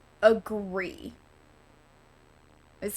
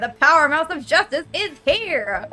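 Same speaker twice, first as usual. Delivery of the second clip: higher, louder heroic proclamation; theatrical rat superhero; 5 semitones higher, 6 dB louder than usual. Acted. Streamer voicing Rat Man's entrance rhyme, bold heroic announcement.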